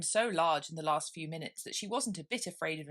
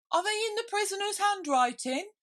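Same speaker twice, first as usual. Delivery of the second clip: high, lightly voiced juror; juryman; 6 dB louder than usual. Juryman asking the Rabbit, high and curious.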